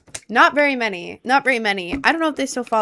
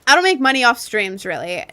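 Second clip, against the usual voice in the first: ordinary delivery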